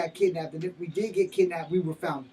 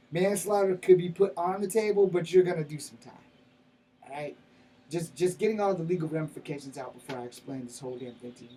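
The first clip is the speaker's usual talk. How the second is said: usual speaking voice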